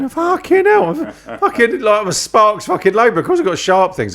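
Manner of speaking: high, exasperated mock alarm; hammed-up incredulous panic, pitched right up; retold panicked thought. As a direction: play up the rising panic in your head. Retold panicked thought before entering the unit.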